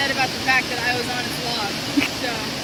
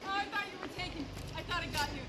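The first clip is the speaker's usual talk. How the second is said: hushed; 4 semitones higher, 15 dB quieter, faster than usual. Real, not acted. Relieved startled hushed talk.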